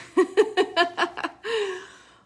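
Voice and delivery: loud, high burst of laughter; laughing. Real, not acted. Host joking with viewers about last week's sound, laughing. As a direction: laugh out loud in a rapid peal.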